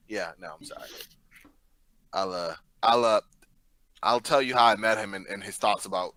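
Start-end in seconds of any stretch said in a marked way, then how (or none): none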